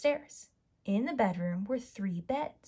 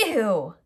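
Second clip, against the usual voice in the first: disgusted ew; 7 dB louder than usual. Acted. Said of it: "she cried".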